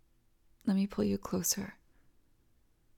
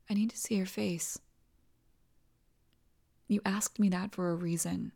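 ordinary delivery

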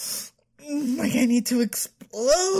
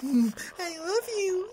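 mock tearful; 6 semitones higher, 6 dB quieter, much slower than usual. Mocking boyfriend's old words.

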